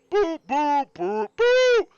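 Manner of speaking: drawn-out, high boos from the crowd; loud booing. Acted. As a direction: boo him loudly from the crowd.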